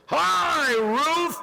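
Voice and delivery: high, loud sing-song crowd chorus; bright unison "Hi, Ruth!" from the room; mock group greeting. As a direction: chirp it like an eager meeting crowd.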